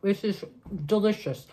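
talking while chewing; chewing between words; mouth partly full. Real, not acted. Seen 1: Bit sandwich at -5, still chewing while talking, sandwich in hands.

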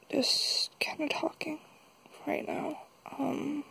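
whispering. Real, not acted. Speaks softly while filming alone.

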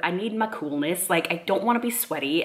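normal talking voice

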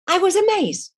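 awestruck, voice jumping high; high-pitched marvelling at the memory; amazed. Real, not acted. Recalls seeing Jesus.

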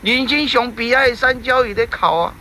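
ordinary delivery

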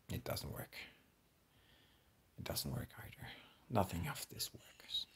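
soft despairing whisper. Real, not acted. Gamer whispering as nothing works. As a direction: breathe it, defeated.

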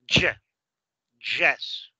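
YE and YES hit as "juh, JESS"; punched J sound. Shown as a demonstration. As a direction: strike the first consonant like English J. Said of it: "tiene un sonido más golpeado".

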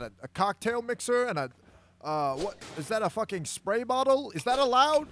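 high excited ringside announcer; sportscaster. Acted. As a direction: call it like a live fight. Mock sports commentator play-by-play.

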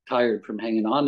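ordinary talk

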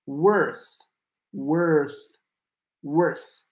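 "worth" with the th breathed out as air; "worth" repeated with an airy th at the end. Shown as a demonstration. Teacher drilling "worth" for a viewer. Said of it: "Y esa T H con aire".

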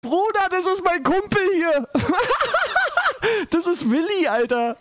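very high-pitched, laughing exclamation; squealing with amusement, laughs breaking in; real helpless laughter. Real, not acted. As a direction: crack up while getting the words out. Cracks up at a dog in clip.

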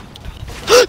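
sudden shocked gasp; sharp gasp. Real, not acted. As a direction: gasp in alarm. Sudden loss in game.

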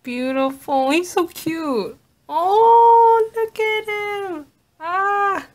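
very high, drawn-out adoring squeal; high swooning coo, slowing as it goes; emotional fawning. Real, not acted. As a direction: gush over someone unbearably cute. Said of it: "Oh no, I'm getting emotional".

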